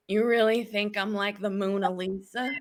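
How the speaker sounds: laughing through the line in her put-on voice. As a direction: chuckle it right in his face. Woman flattered, laughing in his face.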